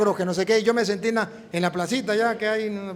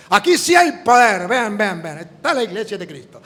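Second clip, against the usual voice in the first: imitando al pastor; 3 dB louder than usual. Retold or imitated.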